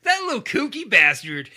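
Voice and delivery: loud, high, hammed-up indulgent cooing; mock-fond gushing. Co-host jokes about the killer as a rascal, mock-fond gushing. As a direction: gush over a naughty rascal, tongue in cheek.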